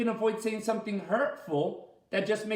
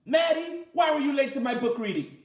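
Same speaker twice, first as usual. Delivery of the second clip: mock accusation; 6 semitones higher, 4 dB louder, faster than usual. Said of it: "I'll be like".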